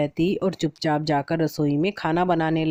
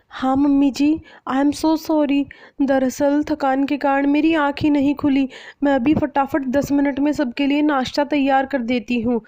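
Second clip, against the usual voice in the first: higher, eager apologetic bride voice; new bride apologizing to her mother-in-law; apologetic young bride; 9 semitones higher, 3 dB louder than usual. Acted. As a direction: say sorry quickly to keep the peace.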